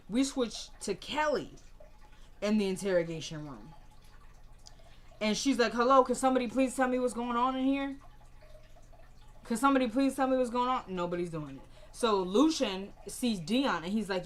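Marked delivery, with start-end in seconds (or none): 5.7-10.8 calling out for answers. Retold or imitated. Detained woman calling out for answers.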